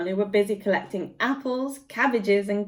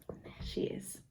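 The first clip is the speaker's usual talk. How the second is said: soft aside whisper; 17 dB quieter than usual. Real, not acted. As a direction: whisper it so the rabbit is not startled. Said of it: "whisper to the pet aside".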